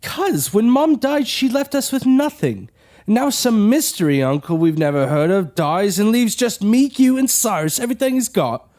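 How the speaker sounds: angry shout. Acted. Brother rants at his sister about the inheritance, angry shout. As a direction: let the old resentment burst out.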